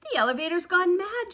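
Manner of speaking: high, loud alarm; alarmed shout. A narrator's reading. Grandpa Joe high in the sky, high loud alarm. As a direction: yell in disbelief. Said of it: "shouted Grandpa Joe".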